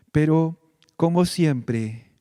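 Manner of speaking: ordinary talk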